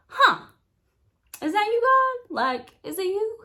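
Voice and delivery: a startled, hopeful asking; surprised wonder. Retold or imitated. She wonders if the thought is God: high, surprised.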